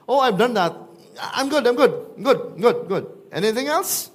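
loud, high, self-satisfied brag; smug young ruler ticking off the list; smug boasting. Acted. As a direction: boast like you've checked every box. Preacher voices smug rich young ruler.

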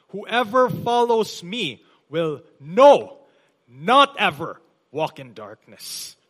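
slow down and punch "no" then "not"; double emphasis on not. Shown as a demonstration. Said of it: "there's a double emphasis on the word not".

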